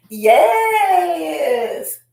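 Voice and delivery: long, high, loud drawn-out yes; excited yell. Real, not acted. Streamer answering a viewer's compliment, excited yell. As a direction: stretch it into a gleeful high holler.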